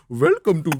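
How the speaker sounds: mock Indian-accented greeting; hammy Indian English; overdone Indian accent. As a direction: mimic dad laying on the Indian accent. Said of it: "lebay banget".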